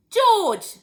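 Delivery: very high, very loud call; grandma yelling for her grandson. A narrator's reading. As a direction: holler the boy's name at full voice. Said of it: "Grandma yelled".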